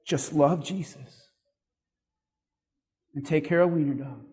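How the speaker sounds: a hint of a dying mother; imitating mom. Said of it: "Right, Mom?".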